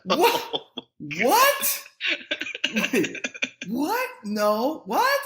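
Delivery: high, rising, incredulous whats; stunned disbelief. Retold or imitated. Storyteller reacting to a shocking proposition, high-pitched disbelief. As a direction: keep asking as if you misheard, pitch leaping up.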